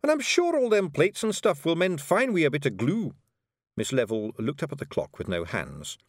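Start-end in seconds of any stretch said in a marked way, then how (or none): 0.0-3.1 high, loud wee Scots warrior voice; Scottish Feegle Rob. A narrator's reading. Rob Anybody brags to Miss Level, high Scots Feegle. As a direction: play a cocky little Feegle, high and brash.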